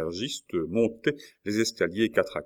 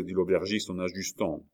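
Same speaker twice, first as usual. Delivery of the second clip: plain reading voice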